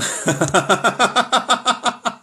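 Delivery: high, rapid cackle; laughing. Real, not acted. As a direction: laugh high in quick bursts. Joking about false teeth.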